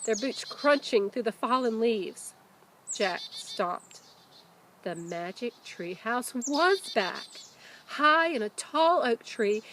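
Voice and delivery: animated storybook narration; reading to children. A narrator's reading. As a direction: tell it like a teacher reading to her class.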